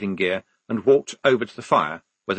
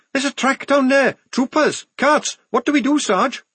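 very high, breathless-with-news report; urgent alarm; 4 dB louder than usual. Acted. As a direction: raise the alarm to the sergeant. Scout's urgent alarm to sergeant.

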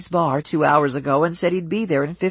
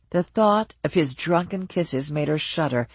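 plain reading voice

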